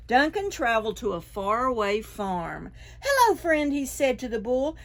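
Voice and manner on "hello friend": bright, much higher cheerful hello; friendly dragon greeting. A narrator's reading. Dragon greeting the raccoon, high and cheerful. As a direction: greet a new friend sweetly.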